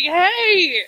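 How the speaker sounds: loud, high, drawn-out protest; mock indignant shout. Iowan answering Minnesota's jibes, mock indignant shout. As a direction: yell it like an insulted neighbour.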